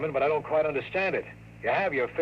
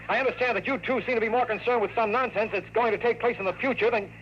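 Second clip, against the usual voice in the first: exasperated outburst; 7 semitones higher than usual. Acted.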